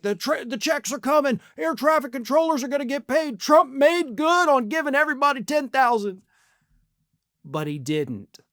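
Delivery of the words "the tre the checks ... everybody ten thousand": high, fast, gushing news hype; mock hype headline. Mocking the media's cheerful headlines in a high, fast hype voice. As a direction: sell it like breathless cable news. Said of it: "all the headlines will be like".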